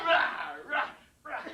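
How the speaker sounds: harsh, voiceless bursts of snarling noise; imitated fight noise. Mailman acts out kicking the dog, rough snarling bursts. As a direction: punch out rough, separate growls. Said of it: "I start kicking this dog".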